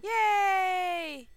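bright, high little cheer; excited cheer. Real, not acted. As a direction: cheer it happily. Cheering about the open door.

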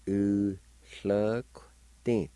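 U, hluk, tin said syllable by syllable with a lateral hl; teacher sounding out U hluk tin; Cherokee syllables. Shown as a demonstration. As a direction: pause between U, hluk and tin. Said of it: "like this".

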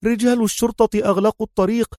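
high woman's voice, lightly panting; breathless. A narrator's reading. Woman runs beside him, high and lightly breathless. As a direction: talk while hurrying. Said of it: "وهي تقول لاهثة".

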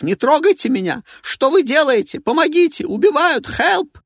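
high, loud desperate screams; a man being attacked, yelling; man screaming for help. Acted. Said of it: "Мужской крик".

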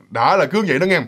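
ordinary delivery

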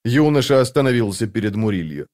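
usual speaking voice

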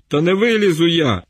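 high whiny mumble; plaintive mumble. Acted. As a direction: plead pitifully, barely articulating. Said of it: "жалібно промимрив начміл".

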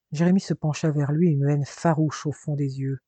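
ordinary delivery, nothing marked

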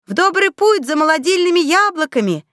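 high, hearty call; rousing send-off. A narrator's reading. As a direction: cheer them off on the journey. Heroine calls out send-off at launch.

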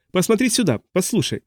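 high-pitched narrated shout; loud call. A narrator's reading. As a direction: hail someone from afar, high but not loud. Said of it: "громко крикнул мужчина". (высокий окрик, без полной силы)

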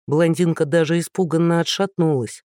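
ordinary delivery, nothing marked

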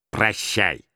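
put-on dismissive old uncle's farewell; put-on voice ending the argument; холодное прощание. A narrator's reading. Scrooge curtly dismisses his nephew. (холодно выпроваживая)

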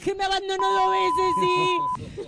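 put-on high, slow reproachful drunk; hammed-up drunk complaint; melancholy drunk. Drunk woman reproaching, acted.

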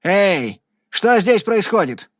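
sharp, high call from behind; curt exclamation. A narrator's reading. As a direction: snap out the question abruptly. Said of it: "отрывистый возглас".

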